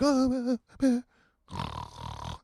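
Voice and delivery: imitating a woman half-waking and dozing off; mock sleepy mumble trailing into a snore; groggy mumble. Comic retelling of a sleeper barely stirring, groggy mumble.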